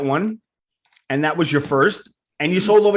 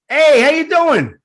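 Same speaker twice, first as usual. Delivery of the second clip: loud, high New York greeting: hey, how you doing; brash New York hello with a big lift; 9 semitones higher, 5 dB louder, slower than usual. Retold or imitated. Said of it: "I interviewed".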